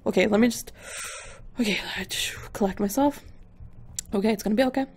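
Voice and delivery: hushed, jittery dread; anxious self-soothing. Real, not acted. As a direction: steel yourself under your breath, nearly whispering. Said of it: "I don't know if I can take this".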